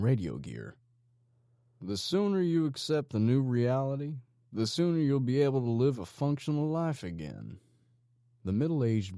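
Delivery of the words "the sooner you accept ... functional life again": a hint of a smug gang boss, higher and a touch louder; lightly voiced menacing leader. A narrator's reading. Gang leader lecturing his handcuffed prisoner, lightly menacing. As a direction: coax the captive with cold confidence.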